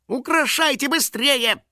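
very high, stretched impatient order; Финик, indignant. A narrator's reading. Impatient friend hurrying decorators, indignant. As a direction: demand speed, pitch shooting up. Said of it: "громко возмущался Финик".

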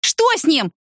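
высокий испуганный возглас; истерика на высоте, но без громкости; истеричный крик. A narrator's reading. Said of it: "истерично закричала она". (истерично)

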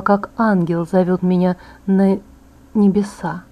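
usual speaking voice

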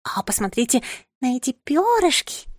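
high chirpy little bird voice; cartoon princess. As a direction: chirp it out like a sweet titmouse. Titmouse keeps admiring feathers. (звонкий щебечущий птичий голосок)

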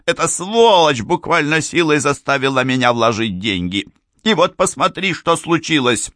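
high-pitched, indignant outburst; trembling with anger. A narrator's reading. Mrs. Amberley blames him for her lost money, high and furious. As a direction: pour out the grievance in a high, angry voice. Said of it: "крикнула она голосом, дрожащим от гнева".

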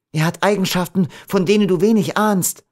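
high, urgent warning; frightened warning. A narrator's reading. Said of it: "als sie vor Schrecken beobachtete".

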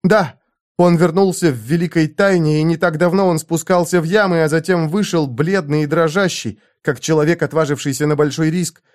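a concubine baring her soul; earnest young woman. A narrator's reading. Zenobia warns Conan.

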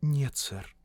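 soft, timid woman's voice; very quiet. A narrator's reading. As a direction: answer barely audibly. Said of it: "Англичанка говорила очень тихо". (очень тихо, робко)

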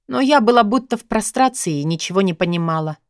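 ordinary delivery

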